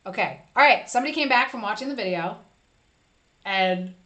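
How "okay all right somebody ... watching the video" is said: usual speaking voice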